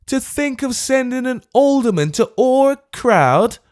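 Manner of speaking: high, loud incredulous pleading; exasperated. Acted. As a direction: plead in comic outrage, dragging the last words. Old man's exasperated plea.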